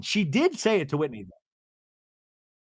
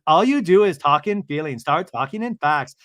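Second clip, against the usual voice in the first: loud, punchy acted rebuke; mock angry scolding; 8 dB louder than usual. Recapper as Lisa attacking talk of feelings, loud scolding. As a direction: lay into them, loudly imitating an argument.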